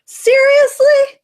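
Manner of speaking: high, slow, drawn-out disbelief; incredulous. Real, not acted. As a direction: stretch it out in shock. Incredulous reaction to tweet.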